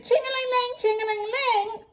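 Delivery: a high, sing-song "ching-a-ling-ling" like a ringing phone; mock phone ring. Imitating phone ringing again.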